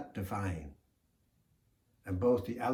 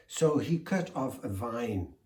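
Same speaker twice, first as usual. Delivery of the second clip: ordinary delivery, nothing marked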